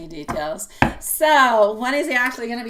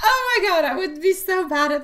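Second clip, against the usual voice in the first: high giggle breaking out; laughing; 10 semitones higher, 5 dB louder than usual. Real, not acted. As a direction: laugh through the words. Laughing at ASMR idea.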